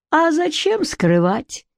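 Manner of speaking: sleepy, drawn-out slow mumble; half-asleep mumble. A narrator's reading. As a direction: mumble it slowly, barely awake. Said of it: "полусонным голосом пробормотал он".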